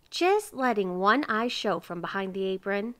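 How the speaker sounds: plain reading voice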